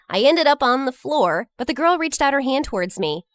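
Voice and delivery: plain reading voice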